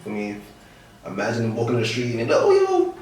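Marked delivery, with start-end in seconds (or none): 2.4-2.9 high excited fan calling out. Retold or imitated. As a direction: call out like a starstruck fan. Fans spotting him on street.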